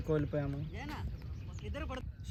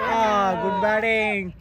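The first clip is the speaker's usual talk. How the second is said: excited cheer; 5 semitones higher, 8 dB louder, slower than usual. Real, not acted. Cheering a good shot.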